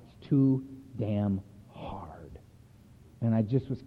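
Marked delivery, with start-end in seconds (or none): 0.1-2.4 slow, worn-out whisper; defeated whisper. Retold or imitated. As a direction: whisper it like you can't go on. Retold moment of giving up.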